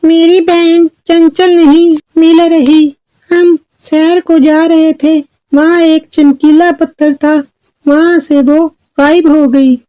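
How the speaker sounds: put-on high-pitched troubled girl; Chulbul. Girl tells fairy of her lost sister, high troubled voice. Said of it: "चुलबुल ने परी से कहा".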